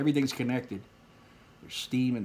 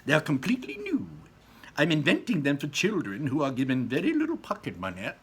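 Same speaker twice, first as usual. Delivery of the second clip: Wonka voice; 6 semitones higher, 4 dB louder than usual. Acted. Wonka explaining his invention.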